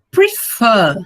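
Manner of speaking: Prefer split in two, second syllable punched; "Prefer" with a strong, held, falling ending; stress on second syllable. Shown as a demonstration. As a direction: hit the last syllable of "Prefer" hard. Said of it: "Ele é mais forte".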